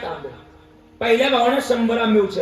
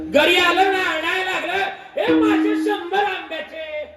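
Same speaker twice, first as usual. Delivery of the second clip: proud shout; 9 semitones higher, slower than usual. Retold or imitated. Brother comes home and boasts of his hundred rupees, shouting.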